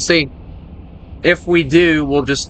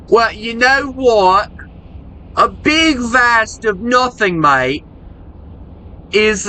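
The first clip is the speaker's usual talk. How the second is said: put-on Aussie accent; 8 semitones higher than usual. Streamer musing on big empty country, high Aussie accent. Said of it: "the Aussie accent".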